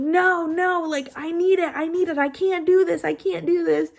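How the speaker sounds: high-pitched retold panic, words repeated in a rush of protest; mock hysterical protest; freaking out. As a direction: act out a frantic plea. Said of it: "I start freaking out".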